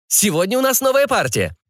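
high-pitched sales patter; coaxing barker. Acted. Barker coaxing a fat client, high-pitched persuasion. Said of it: "продолжал увещевать толстяка зазывало".

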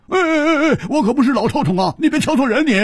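high, loud and fast protest; yelling. A narrator's reading. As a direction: yelp in alarm as the blanket is pulled off, fast. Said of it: "胡铁花大叫着说".

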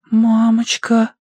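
slow, high breathy gasp; breathed out. A narrator's reading. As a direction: sigh the word out in shock. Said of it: "выдохнула Ксения".

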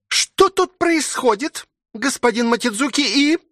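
very high-pitched, shocked question; horrified maid. A narrator's reading. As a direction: squeak the question, breaking off aghast. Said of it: "с ужасом прикрыла рот".

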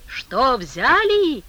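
frenzied yell, pitched high; madman shouting. Acted. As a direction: shout it like a man gone mad. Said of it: "закричал безумный человек".

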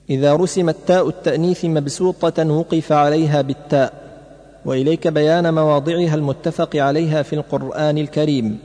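ordinary talk, nothing marked

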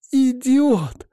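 high tearful cry; sobbing shout. Acted. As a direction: sob it out through tears. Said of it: "навзрыд выкрикнула она".

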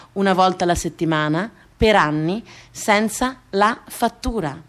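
normal talking voice

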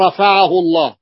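بل رفعه read with the lam merged into a doubled ra. Shown as a demonstration. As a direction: say "bar-rafa'ahu". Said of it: "لاحظوا، نحو بل".

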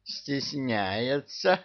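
high, very slow tender coo; touched Koschei. A narrator's reading. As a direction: gush with fond delight. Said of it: "умилился Кощей". (умилённый Кощей)

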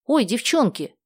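high, eager cry; excited exclamation, pitch leaping up; exclaiming. A narrator's reading. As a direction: call out with a sudden bright idea. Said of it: "воскликнула Даша".

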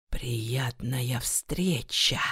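slow whisper in her ear. A narrator's reading. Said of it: "прошептал нотариус ей на ухо". (шёпот на ухо)

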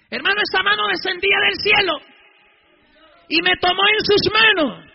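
loud, high-pitched testimony; full-force preaching. Real, not acted. Preacher telling congregation of the hand from heaven, full-force preaching. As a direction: proclaim it to the congregation.

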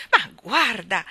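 loud cry of amazement; astonished shout. A narrator's reading. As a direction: exclaim loudly in disbelief. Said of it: "gridò".

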